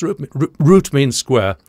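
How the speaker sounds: ordinary talk, nothing marked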